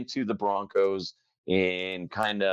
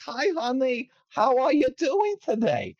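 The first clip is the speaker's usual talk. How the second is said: mom impression; 14 semitones higher than usual. Host starting his mom voice, high caricature. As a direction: greet like a cartoonish mother. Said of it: "start the voice with that".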